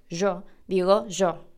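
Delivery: "yo" said as "sho" with a zh/sh sound, slowly; Argentine sh-sound Y. Shown as a demonstration. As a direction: say "yo" with the Rioplatense zh sound. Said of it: "la Y, por ejemplo".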